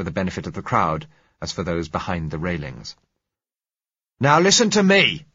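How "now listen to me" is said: loud, high, furious demand; angry shout. Acted. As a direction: shout him down at the gates. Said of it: "he said angrily".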